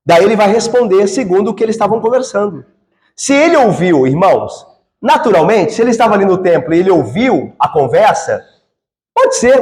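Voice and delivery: ordinary talk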